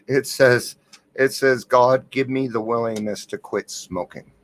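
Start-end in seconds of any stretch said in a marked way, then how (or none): none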